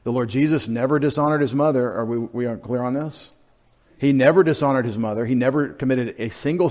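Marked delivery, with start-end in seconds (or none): none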